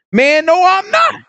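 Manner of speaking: high, drawn-out mock shout; indignant, stretched high-pitched outburst; emphatic denial. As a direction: play Peter protesting at the top of his voice. Peter's final denial, acted.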